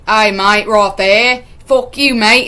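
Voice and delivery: loud, broad put-on Australian; mock Australian accent. Girl telling off the old man, mock Australian accent. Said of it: "not making fun of Australian people".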